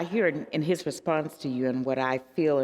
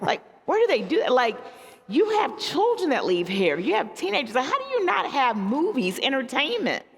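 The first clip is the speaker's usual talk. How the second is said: imitating the police chief; 9 semitones higher than usual. Pastor quotes the chief asking about missing theaters, high mock disbelief. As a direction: play the baffled chief, pitched way up. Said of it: "I can't say it in his Puerto Rican accent".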